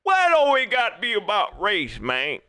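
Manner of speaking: mock voice of a fan who denies race matters; mocking a doubter. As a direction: sneer it like a fan waving the issue off. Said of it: "y'all watching this and saying".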